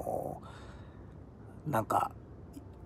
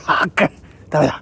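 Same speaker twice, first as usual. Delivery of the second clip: strained cry as arms give out; giving up mid push-up, loud; doing push ups; 3 semitones higher, 10 dB louder than usual. Real, not acted.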